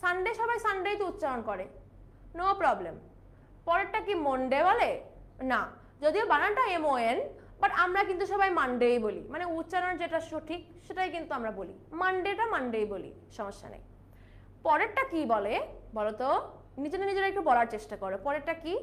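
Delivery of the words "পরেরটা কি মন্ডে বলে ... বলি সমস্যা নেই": normal talking voice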